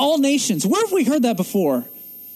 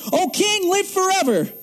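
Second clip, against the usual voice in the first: joyful, higher greeting to the king; happy Daniel greeting; 9 semitones higher than usual. Retold or imitated. Daniel greeting the king from the den, lightly happy. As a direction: lift it up gladly. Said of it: "He's so happy to see the king".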